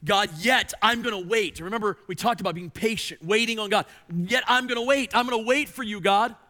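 high, loud climactic sermon voice; fervent preaching. Acted. Pastor declaring trust in God, loud fervent preaching. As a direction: build to a passionate climax.

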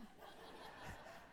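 a real airless chuckle at himself; silent breathy laugh. Real, not acted. Pastor laughs softly at his own fighting talk, airless chuckle.

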